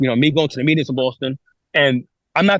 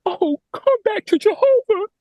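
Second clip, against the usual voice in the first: mocking sing-song elder. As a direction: parody the elders' fake sweet pitch. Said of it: "what do they say?".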